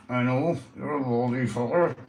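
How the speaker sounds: ordinary talk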